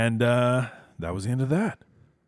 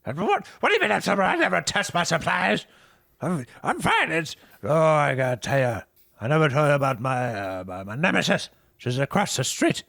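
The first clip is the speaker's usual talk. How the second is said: much higher, louder flustered denial; defensive Herb; 7 semitones higher, 6 dB louder than usual. Acted. Herb insisting to Jack he is sober, a high flustered rant. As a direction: deny it all in a fluster. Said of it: "Herb voiced in scene".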